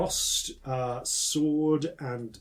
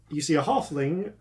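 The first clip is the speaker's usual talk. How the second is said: ordinary talk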